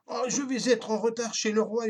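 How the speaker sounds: mock tantrum of a late baron; raging baron. A narrator's reading. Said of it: "hurla-t-il en trépignant de rage".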